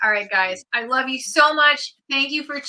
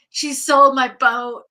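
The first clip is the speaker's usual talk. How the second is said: louder, drawn-out mock dismay; imagined tearful dad; 3 semitones higher, 8 dB louder, slower than usual. Daughter imagining her dad finding the boat sold, mock upset. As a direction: imitate an upset dad, loud and slow. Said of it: "My dad would so cry".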